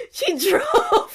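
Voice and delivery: high laughing cry. Real, not acted. Recalling her friend rushing over, laughing hard. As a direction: laugh it out, overwhelmed.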